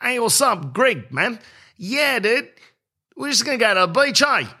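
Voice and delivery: high mocking Australian voice; Aussie bloke impression. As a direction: send up a laid-back Aussie mate making beach plans. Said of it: "In the Aussie community, they don't do that. They go".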